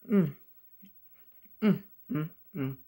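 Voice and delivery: humming while chewing food; closed lips around food; mouth full, chewing. Real, not acted. Seen 3: Broccoli bite taken at -1.0; lips closed, moving through the hums.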